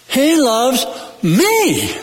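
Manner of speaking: slow, ME set apart, high and falling; pause, then punch a long high ME; stress on ME. Shown as a demonstration. Preacher shows the church another emphasis, ME stressed. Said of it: "any way you want to emphasize".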